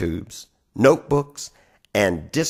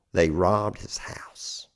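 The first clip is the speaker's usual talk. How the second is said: hushed whisper; 6 semitones lower, 7 dB quieter, slower than usual. Acted. Dramatic turn in the poem.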